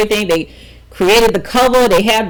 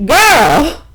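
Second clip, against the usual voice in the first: high, loud mom voice laughing through it; a loud, laughing mother on the phone; 9 semitones higher, 4 dB louder, slower than usual. Retold or imitated. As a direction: play the amused mom who already knows. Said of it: "my mom on the phone".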